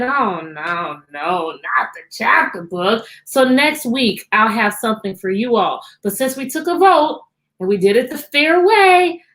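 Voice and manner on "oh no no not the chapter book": lower, slow mock protest; a hint of a reluctant student; mocking whiny kid. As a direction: imitate a groaning kid, lightly. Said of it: "two of you that said".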